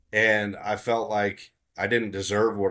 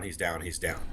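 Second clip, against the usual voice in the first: whispered hunter's report; softer whisper confirming the kill; 4 semitones lower, 8 dB quieter than usual. Retold or imitated. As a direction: breathe it out, barely voiced. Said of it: "doing the hunter thing".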